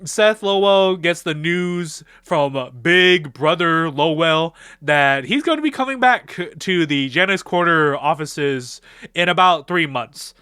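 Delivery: normal talking voice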